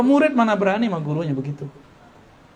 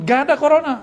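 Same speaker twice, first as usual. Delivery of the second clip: a brash, dismissive scoff, pitched higher; mock the arrogant denier's swagger; lightly hammed-up boast; 7 semitones higher, 4 dB louder, faster than usual. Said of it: "Kalau orang takabur ngomong".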